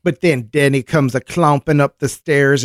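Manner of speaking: ordinary talk, nothing marked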